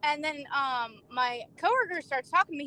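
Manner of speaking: normal talking voice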